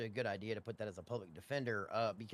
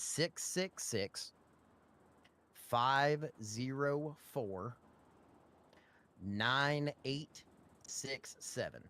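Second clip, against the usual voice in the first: dictating; 2 semitones higher, much slower than usual. Real, not acted. Dictating phone number slowly.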